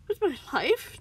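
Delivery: high, loud, alarmed question; nervous. A narrator's reading. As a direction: ask it high and anxiously. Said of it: "the cat asked nervously".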